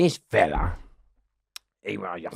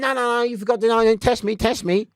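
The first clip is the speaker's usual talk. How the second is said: higher, pushy, keen begging to be tested; mock eager insistence; 12 semitones higher, 3 dB louder than usual. Prisoner cheekily urging guards to drug test him, mock eager. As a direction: sound overeager, almost cheeky.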